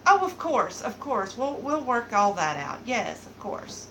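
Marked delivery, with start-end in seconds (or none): none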